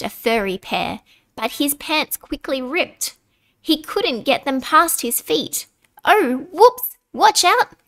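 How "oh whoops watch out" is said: plain reading voice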